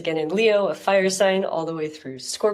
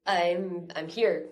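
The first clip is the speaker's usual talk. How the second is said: stuffy, blocked nose voice; congested. Real, not acted. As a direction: talk through a blocked nose. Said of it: "I'm a little congested".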